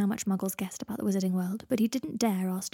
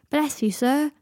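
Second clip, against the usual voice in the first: loud, higher-pitched show of thanks; 7 semitones higher, 6 dB louder, slower than usual. A narrator's reading. Seven thanking Draco for money in the street, loudly. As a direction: call it out for all to hear. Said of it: "said Seven loudly".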